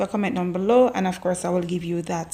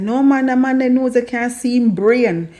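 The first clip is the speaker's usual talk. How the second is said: louder mock Jamaican patois man; retold patois jeer; 4 semitones higher, 4 dB louder than usual. A man at the bus stop mocking a big nose in patois. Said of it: "a man said".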